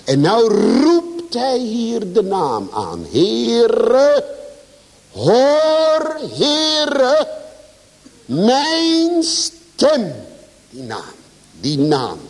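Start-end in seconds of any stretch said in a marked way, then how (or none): 3.1-10.1 fervent cry. Acted. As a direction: cry out to God. Crying out the prayer.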